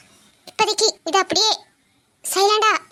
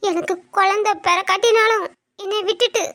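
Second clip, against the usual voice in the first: tearful, halting plea; broken phrases with sobbing pauses; crying. Acted. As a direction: beg your husband through tears. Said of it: "அக்கா ஏன் அழுதுகிட்டு இருக்கா?".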